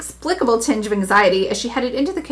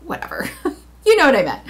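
laughing; 3 semitones higher, faster than usual. Real, not acted. Reader laughs at own slip.

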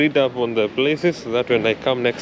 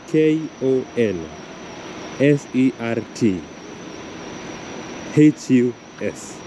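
spelling out letters; 3 semitones higher than usual. Shown as a demonstration. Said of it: "the spelling is".

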